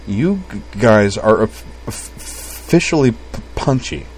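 halting stutter on first sounds; stuttered, effortful speech; stammering. A narrator's reading. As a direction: catch on "g", "of" and "p" before each word lands. Kate stammers in story.

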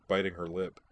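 usual speaking voice

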